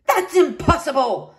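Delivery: loud indignant bluster. A narrator's reading. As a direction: bluster like a pompous knight. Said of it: "blustered Horace".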